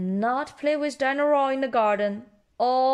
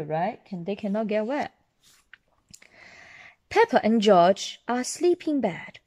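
usual speaking voice

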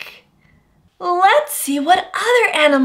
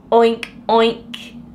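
pig oink; 6 semitones lower than usual. Acted. Pig sound for child.

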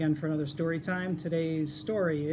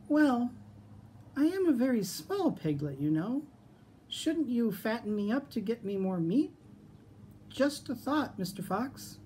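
high, small sly piglet voice; 7 semitones higher than usual. Acted. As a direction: play the clever little piglet. Piglet voice in story.

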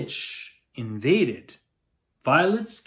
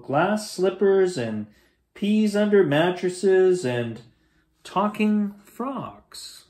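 dreamy drawn-out; 4 semitones higher than usual. Acted. Dreamy list of magical things.

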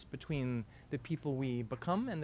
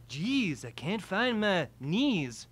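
slow, louder Dylan drawl; mock Dylan mumble-singing; [name] impression; 5 semitones higher, 6 dB louder, much slower than usual. Boy shows Rachel his slow [name] impression. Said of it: "[name]?".

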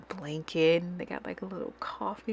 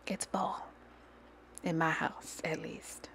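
playful whisper; 8 dB quieter than usual. Real, not acted. Declaring her fall rule.

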